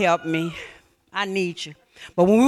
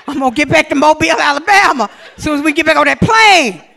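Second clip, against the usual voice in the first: fast, louder, laughing declaration; laughing shout; 4 semitones higher, 7 dB louder than usual. Retold or imitated. Comic vow, laughing shout.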